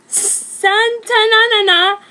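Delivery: can't get the name out for laughing; laughing while reading. Real, not acted.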